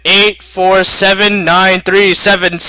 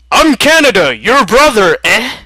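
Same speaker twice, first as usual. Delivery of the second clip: higher, louder, ending with eh; chirpy Canadian brother; Canada voice; 6 semitones higher, 4 dB louder than usual. Acted. Canada introducing himself to America, loud and high.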